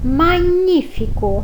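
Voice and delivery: magnífico slow and high, with gn as nh; magnífico devagar e agudo; slow Italian word. Shown as a demonstration. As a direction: lift and slow magnífico, maɲifiko. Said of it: "mas a pronúncia fica".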